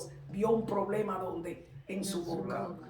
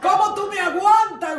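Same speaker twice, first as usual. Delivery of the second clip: high, very loud cry to God; grito a Dios; 9 semitones higher, 10 dB louder than usual. Acted. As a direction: cry out in exclamation to the Lord. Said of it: "cuando gritó así". (clamor agudo a gritos)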